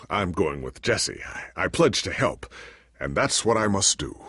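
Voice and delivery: usual speaking voice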